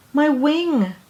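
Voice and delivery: high, pained little bird cry. Acted. Injured bird cries to the mouse in pain. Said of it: "cried a bird".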